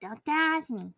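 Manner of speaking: put-on high, pouty little kid voice; put-on childish voice. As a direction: whine it like a small child. Repeating the catchphrase in voice.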